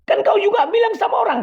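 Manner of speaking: real shouting mid-quarrel; angry shout. Real, not acted. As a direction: hurl the accusation at him. Heated argument, accusing.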